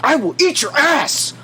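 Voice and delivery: high, slow ranting conspiracy host; drawn-out hammy rant; Alex Jones. Retold or imitated. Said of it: "go all Alex Jones on us".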